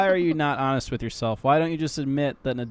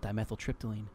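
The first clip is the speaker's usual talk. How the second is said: whispering up close; 2 semitones lower, 7 dB quieter than usual. Acted. Smokey creeping up on a sleeping friend, whispering. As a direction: murmur it softly, as if into a sleeper's ear. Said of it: "he whispers up, and he's like".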